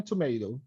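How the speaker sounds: tomato said American, "tuh-MAY-doh"; American pronunciation. Shown as a demonstration. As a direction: say tomato with an AY vowel and soft tapped t. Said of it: "بالأمريكان". (نطق tomato بالأمريكي "توميدو")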